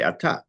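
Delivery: "ata" accented on the final syllable, a-TA; stress on second syllable. Shown as a demonstration. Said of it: "put the accent on the second syllable".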